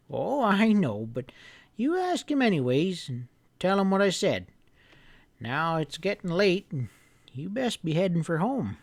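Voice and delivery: put-on an old storekeeper giving friendly orders; old grandpa voice. Grandpa telling the boy to bring the hounds early, folksy.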